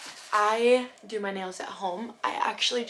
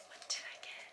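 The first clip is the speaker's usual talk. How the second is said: hushed excited whisper; 14 dB quieter, faster than usual. Retold or imitated. Whispering her own thought while opening packages. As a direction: breathe it like a secret. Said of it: "I'm just like".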